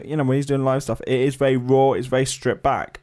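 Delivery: ordinary talk, nothing marked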